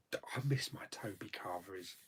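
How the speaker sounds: soft unvoiced sigh of longing; wistful whisper. Real, not acted. Missing his favourite carvery, wistful whisper.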